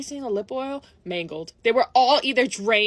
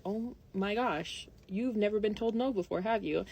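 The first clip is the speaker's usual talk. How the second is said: hushed realisation; 2 semitones lower, 9 dB quieter than usual. Retold or imitated. Watching a little girl scream, she realises, in a hushed aside. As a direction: let it sink in, very quietly.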